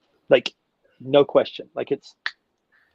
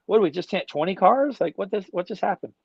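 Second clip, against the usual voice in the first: higher-pitched acted bewilderment; mock disbelief; 6 semitones higher than usual. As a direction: replay the baffled reaction in a raised pitch. Acting out stunned tinters afterwards.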